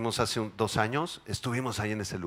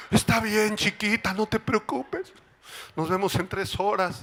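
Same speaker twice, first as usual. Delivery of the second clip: soothing husband; 9 semitones higher than usual. Pretend.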